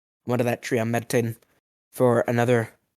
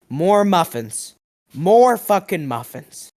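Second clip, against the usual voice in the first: slow, building, louder indignation; mock outrage; 5 semitones higher, 4 dB louder, slower than usual. Griping that the baker won't share a muffin, mock outrage. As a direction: stretch it out, getting more worked up. Outraged about being left out.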